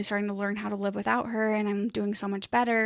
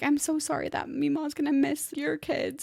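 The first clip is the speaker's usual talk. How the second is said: higher-pitched quote of a sorrowful niece; retold heartfelt apology, pitched up; tearful niece; 6 semitones higher than usual. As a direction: lightly imitate a young girl's sad apology. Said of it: "'cause she's just like".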